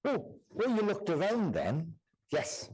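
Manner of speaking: ordinary talk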